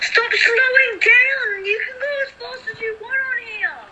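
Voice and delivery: high, whiny yell at teammate; frustrated yell. Real, not acted.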